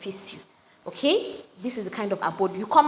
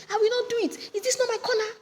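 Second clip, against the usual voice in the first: much higher, defiant neighbor's retort; indignant refusal; 12 semitones higher than usual. Retold or imitated. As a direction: throw it back at the complainer. Neighbor refuses to move gen.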